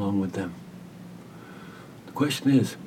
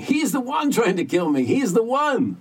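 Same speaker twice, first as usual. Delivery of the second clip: much higher and louder accusing shriek; retold panicked scream; 14 semitones higher, 5 dB louder than usual. Dying Jake accuses his friend, high panicked scream. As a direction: scream it, pointing at the man beside you. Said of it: "he started screaming".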